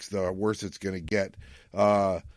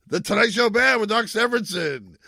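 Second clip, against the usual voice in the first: announcer voice; 12 semitones higher, 7 dB louder than usual. Pretend. Mock TV show announcer intro.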